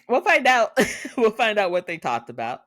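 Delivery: excited teaser. Real, not acted. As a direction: build suspense with a giggle. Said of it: "excited teaser after outburst".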